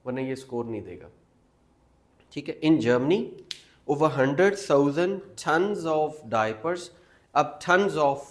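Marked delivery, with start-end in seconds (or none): 2.6-8.2 louder, slow model reading; slow read-aloud. Shown as a demonstration. Teacher reading a passage aloud for students to repeat. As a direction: read it out slowly for them to repeat. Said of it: "you have to repeat after me".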